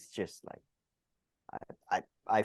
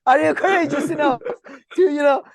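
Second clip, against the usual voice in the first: higher and louder, sneering mock question; mocking sarcasm; 9 semitones higher, 5 dB louder than usual. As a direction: ridicule the idea like a scornful heckler. Mocking imagined modern courage.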